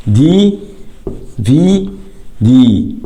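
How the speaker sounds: دي في دي as three separate long letters. Shown as a demonstration. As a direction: pause after each letter of دي في دي. Teacher sounds out each letter.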